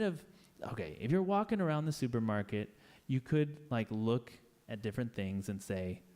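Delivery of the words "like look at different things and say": ordinary talk, nothing marked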